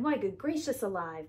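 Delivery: usual speaking voice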